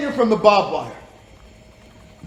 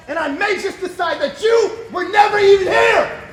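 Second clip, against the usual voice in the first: a higher, louder threat; threatening shout; 8 semitones higher, 5 dB louder than usual. Acted. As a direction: yell the threat in his face. Interrogator threatens the prisoner.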